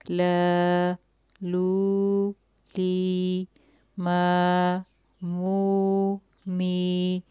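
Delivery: slow, clear sound-by-sound drill; recitation drill. Shown as a demonstration. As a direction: chant each sound for the child to repeat. Drills letter sounds for a child. (بچوں کو حروف کی مشق کرانا، صاف صاف)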